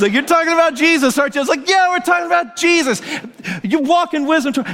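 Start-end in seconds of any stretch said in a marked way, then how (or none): 0.1-1.4 high, eager imitated question; excited guess. Woman walking in on bawling friend, excited guess. 1.7-3.0 very high, fired-up retold answer; exultant reply. Preacher answering at the kitchen table, exultant.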